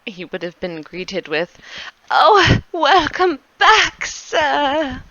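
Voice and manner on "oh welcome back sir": high, slow, fawning servant greeting; bow and welcome the master back. Acted. Servant Anton greets his master, high and fawning.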